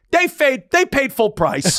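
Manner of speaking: loud, high, slow gloating punchline; triumphant declaration. Retold or imitated. Funeral director boasting nobody won his bet, triumphant. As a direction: crow it as an imitated winner.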